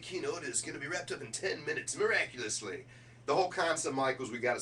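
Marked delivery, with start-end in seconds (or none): none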